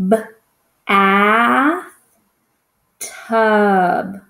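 b alone, a long held "a", then a drawn-out "tub"; sounded-out word. Shown as a demonstration. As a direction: stretch each sound of "B, a, tub" with gaps. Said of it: "Which vowel sounds do we hear?".

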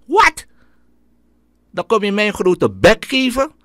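sharp high loud "what?"; outraged shout. Retold or imitated. Teacher shouting back at a cheeky pupil, loud and high. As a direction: bark it back in disbelief.

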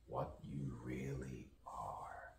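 slow, voiceless whisper. Acted. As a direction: breathe the words out slowly. Whispered mantra.

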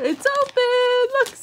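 high, loud, drawn-out excitement; big high-pitched reveal to a pet; excited announcement. Real, not acted. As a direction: thrill the dog with the news. Showing dog the opened box.

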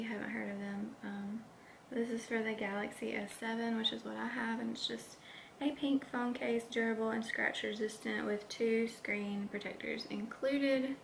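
normal talking voice

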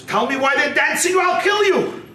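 high, acted angry outburst; storyteller voicing a warden's threat; threatening demand.

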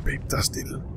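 hushed whisper to his partner. Real, not acted. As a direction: whisper it so nothing hears. After "Tys", hushed to partner.

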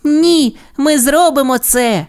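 very high, insistent cartoon voice; determined pup. A narrator's reading. Sky refuses to let Ace jump, determined high pup voice. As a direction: refuse to give up, high-pitched. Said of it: "не здавалася Скай".

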